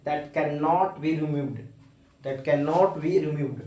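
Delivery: each word spaced out slowly for students; slow, deliberate teacher dictation. Shown as a demonstration. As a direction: dictate it slowly so they can write it down.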